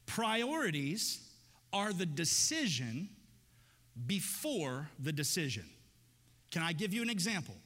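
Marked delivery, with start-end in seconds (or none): 0.0-5.6 slow again, long pause before it; slow emphatic repeat. Shown as a demonstration. Preacher repeating the key idea to the congregation, slow. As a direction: let the line sink in. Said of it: "Think about this for a second".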